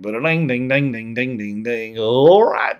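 hammed-up Southern banjo-like twang; mock Southern twang. Making fun of some Southerners, exaggerated Southern twang. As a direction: twang out the syllables like a caricature Southerner. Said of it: "you have some people that, like".

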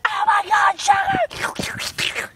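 tiny, very high squeal; mock freak-out in a squeaky voice; ants freaking out. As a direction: shriek like frantic little ants. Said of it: "They're, like, freaking out right now".

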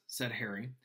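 ordinary delivery

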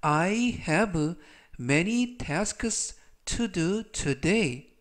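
very slow, each word spaced out; slow model sentence. Shown as a demonstration. Teacher reads the example sentence slowly to the class. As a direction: read it slowly for students to follow. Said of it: "전체적 의미는".

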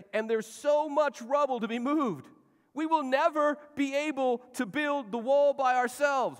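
high, loud, slow despairing wail; despairing workers. Acted. Weary workers complain about the rubble, loud slow wail. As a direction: cry out that it can't be done.

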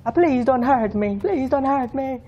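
high pleading horror-movie victim; terrified horror victim. Pretend. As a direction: beg for your life, hammed up. Begging in mock panic.

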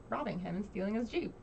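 normal talking voice

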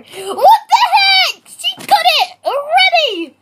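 high loud laughing shriek; shocked shriek. Real, not acted. Shocked at friend's reply.

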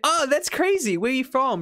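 high-pitched mock small-talk enthusiasm; polite amazement. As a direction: act politely impressed. Retelling own polite small-talk reaction.

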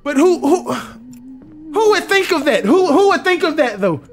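high, drawn-out exasperation; high-pitched, stretched complaint at unfair logic; incredulous protest. Real, not acted. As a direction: wail it in disbelief.

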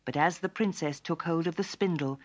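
plain reading voice

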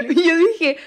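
laughing through the words; giggling as she tells it; amused laugh. Real, not acted. Laughing while retelling.

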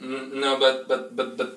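normal talking voice

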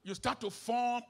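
loud, sing-song preaching, rising on each virtue; full-force preaching. Acted. Pastor preaching character to his congregation, loud and sing-song.

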